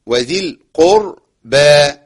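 القربى slow, with a heavy q and a full, thick r; full-mouthed Quran recitation. Shown as a demonstration. Teacher models القربى in slow full recitation. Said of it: "وراء مفخمة".

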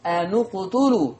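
slow أن اقتلوا with the nun clearly rounded "anu qtulu"; أن اقتلوا بضم واضح; stressed damma on nun. Shown as a demonstration. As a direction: lean hard on the u after the nun. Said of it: "أنا بأكد عليها أهو".

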